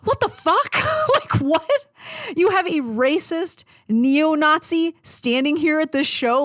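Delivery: high, stunned disbelief; can't believe what he's seeing; shocked outrage at a neo-Nazi at the show; incredulous. Retold or imitated. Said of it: "And I'm like".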